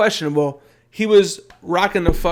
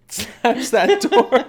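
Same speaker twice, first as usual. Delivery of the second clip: slow, higher, laughing through it; laughing retell; 7 semitones higher, much slower than usual. Retold or imitated. Retelling the door slap to co-hosts, laughing. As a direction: crack up as you repeat it.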